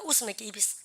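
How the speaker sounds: ordinary talk, nothing marked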